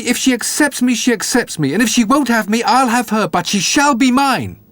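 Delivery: pushing his case with mild excitement; eager Jack. A narrator's reading. Jack pleads for a horse.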